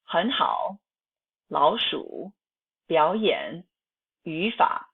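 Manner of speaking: 很好, 老鼠, 表演, 语法, first syllable rising, second dipping; third-tone change. Shown as a demonstration. Teacher models examples, second tone before third. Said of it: "the first syllable transforms into the second tone".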